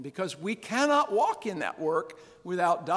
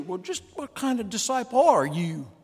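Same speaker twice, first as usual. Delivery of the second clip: louder mock-tough challenge; challenging taunt; 3 semitones higher, 6 dB louder than usual. Preacher challenging the congregation, mock tough. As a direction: goad the church like the radio man. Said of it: "I wanna say to you".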